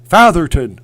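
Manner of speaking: badly faked cartoon Texan dad; loud attempt at an exasperated cartoon dad; half-successful Texan dad impression, loud; Hank Hill. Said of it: "I can't do Hank Hill".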